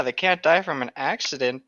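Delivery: ordinary talk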